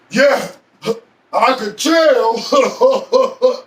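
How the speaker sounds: imitated jeer, slowing into a mocking laugh; mock him, drag it out and laugh; sarcastic mockery. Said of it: "He said it real sarcastic".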